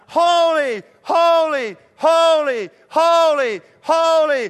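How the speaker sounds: high, loud ringing cries; shouting. Acted. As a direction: fire off each one at full voice. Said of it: "they're shouting back and forth".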